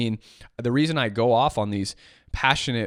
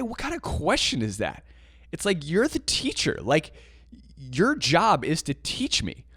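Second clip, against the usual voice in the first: raised-pitch, indignant retort; exasperated inner reaction; 4 semitones higher than usual. Retold or imitated. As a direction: protest to the teacher in your head. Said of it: "in my head, I'm like".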